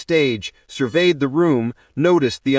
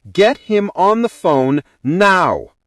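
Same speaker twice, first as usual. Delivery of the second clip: high, loud, slow command; urgent order; 6 semitones higher, 5 dB louder than usual. A narrator's reading. Bride orders bridesmaids to reach the groom, demanding. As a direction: punch out the order, landing hard on the last word.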